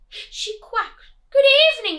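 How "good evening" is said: high loud greeting call; duck calling into cave. Acted. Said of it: "the duck began to quack".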